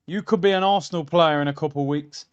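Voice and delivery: normal talking voice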